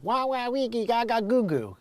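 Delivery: imitated baby gibberish, starting high; baby babble. Himself as a baby stuck up a tree, mock babbling.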